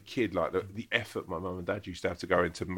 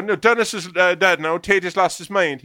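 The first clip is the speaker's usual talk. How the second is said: high, loud Irish landlady voice; landlady's voice; 11 semitones higher, 11 dB louder than usual. Retold or imitated. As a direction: bark it out like a brusque old landlady.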